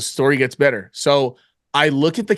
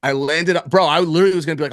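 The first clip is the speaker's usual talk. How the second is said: ordinary delivery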